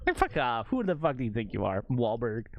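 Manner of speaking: ordinary talk, nothing marked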